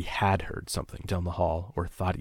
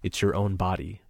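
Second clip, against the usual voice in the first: ordinary delivery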